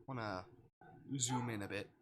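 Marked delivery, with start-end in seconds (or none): none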